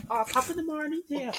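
high, hammed-up stage Irish brogue; mocking stage Irish accent. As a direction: parody the fake Irish greeting tourists use, pitched high. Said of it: "all that rubbish stuff".

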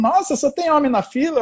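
high, astonished wife voice; surprised wife. Retold or imitated. Wife is amazed that the queue is all men, high surprised voice. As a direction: she notices only men in line.

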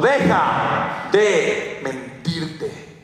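drawn out very slowly, mock yell; retold shout. John Maxwell confronts Spencer, a slow stretched mock yell. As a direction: stretch every word of "Deja de mentirte" like a stern command. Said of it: "me pegó un grito".

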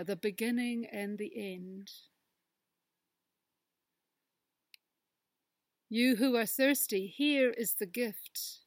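slow, hushed prayer voice; prayer reading. Acted. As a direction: read it like a gentle prayer. Reads closing prayer aloud.

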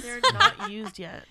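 high, loud, slow laughing protest. Real, not acted. Friend defending her tissues to a grossed-out friend, laughing.

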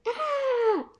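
long, very high "aaah" scream without full volume; bigger mock yell. A narrator's reading. Mouse out-yelling the shadows, long high mock yell. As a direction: stretch out a high-pitched story scream. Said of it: "I yelled louder".

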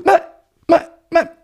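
shrill-free high outrage; protest, pitch jumping up; outraged high protest. Acted. Phone thrown away, outraged.

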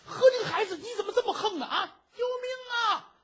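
high, loud hammed-up cry; a beaten scoundrel howling for help; comic yelp for help. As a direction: yowl in pain and panic, overplayed. Villain hit, cries for help.

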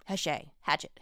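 Hachette first as "ha-SHAY", then as "HATCH-it"; alternating pronunciations. Shown as a demonstration. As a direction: flip from French Hachette to English hatchet. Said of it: "it should be pronounced hatchet".

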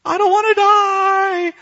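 loud, very high, drawn-out wail; hammed-up child's crying howl; mock sobbing child. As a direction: bawl it slowly like a little girl. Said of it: "she's just uncontrollably sobbing".

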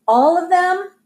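high, rising disbelief; incredulous question. A narrator's reading. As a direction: ask it as if you can't believe it. Daughter reacts to shocking invitation.

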